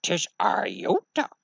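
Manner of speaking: slow, odd creature voice; quirky little alien mentor; old Jedi master. Acted. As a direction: croak it slowly like a swamp-dwelling sage. Old Jedi master greets visitor.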